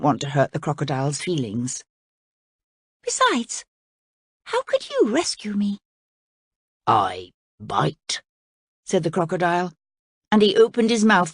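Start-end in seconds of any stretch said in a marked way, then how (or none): 3.0-5.8 put-on high curious girl's question; young princess. A narrator's reading. Voices the princess. 6.9-8.2 low, slow sly crocodile; pleased crocodile teasing the princess, low and slow. A narrator's reading. Voices the crocodile.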